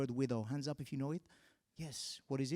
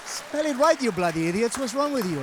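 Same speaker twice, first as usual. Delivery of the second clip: mock rant; 6 semitones higher, 8 dB louder than usual. Real, not acted. Ranting at script writers.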